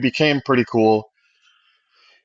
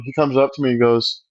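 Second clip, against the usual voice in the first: normal talking voice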